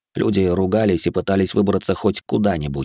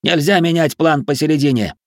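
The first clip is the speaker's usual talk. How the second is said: high, raised voice; exasperated, raised-pitch insistence; heated objection; 7 semitones higher, 3 dB louder than usual. A narrator's reading. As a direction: refuse firmly mid-quarrel. Angry quarrel over the plan.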